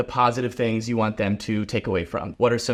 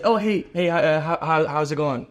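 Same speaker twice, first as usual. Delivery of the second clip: hesitant, mumbled hello trailing down; 3 semitones higher than usual. Shown as a demonstration. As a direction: stumble through an unenthusiastic greeting. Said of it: "if you said".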